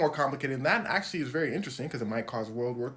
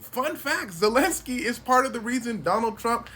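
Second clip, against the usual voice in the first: usual speaking voice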